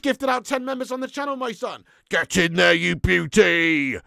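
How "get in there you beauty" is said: much louder, last words slowly stretched; stretched-out cheer; loud celebration. Real, not acted. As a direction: bellow it in joy. Celebrating donor.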